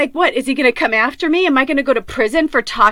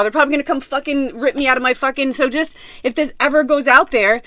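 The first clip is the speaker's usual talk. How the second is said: ordinary delivery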